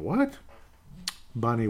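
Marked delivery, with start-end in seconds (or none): 0.0-0.4 leaping squeak of a what, way up high; high, cartoonish doggy double take; startled what. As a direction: yelp it like a startled dog. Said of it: "a dog going".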